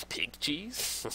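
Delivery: hushed, rising questioning tone; soft, incredulous question; amused disbelief. Real, not acted. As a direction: read it out softly like you can't believe it. Baffled by odd menu item, laughs after.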